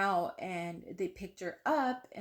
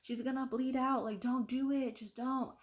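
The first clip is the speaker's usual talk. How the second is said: higher, urgent mock yell without extra volume; retold yelling; 5 semitones higher than usual. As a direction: imitate a doctor frantically stopping someone. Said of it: "comes walking in behind me yelling".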